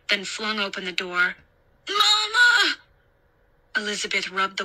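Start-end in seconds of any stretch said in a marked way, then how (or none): 1.9-2.8 put-on high, loud wail of a scared boy; screaming little-boy voice, drawn out; terrified child's cry. A narrator's reading. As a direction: cry out in panic for mother. Frightened boy screams for his mother.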